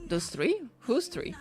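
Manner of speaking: ordinary talk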